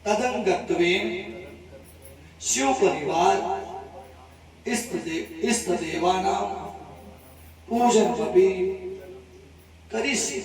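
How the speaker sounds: measured, word-by-word Sanskrit intoning; ritual sankalp recitation. Acted. Priest reciting the sankalp for the devotee in measured intoning. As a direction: intone each phrase of the vow with pauses between.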